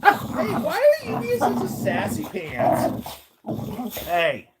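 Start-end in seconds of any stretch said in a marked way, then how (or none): 0.0-3.1 playful pet scolding. Real, not acted. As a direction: chide a sassy pet in a squeaky-high voice.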